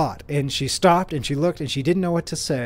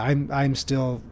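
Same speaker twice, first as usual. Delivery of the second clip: usual speaking voice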